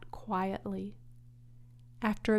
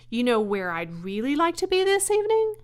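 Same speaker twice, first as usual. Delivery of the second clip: fast, higher and louder, eager; 6 semitones higher, 7 dB louder, faster than usual. Acted. Wilbur telling Charlotte his wish, eager. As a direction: rush out the dreamy wish.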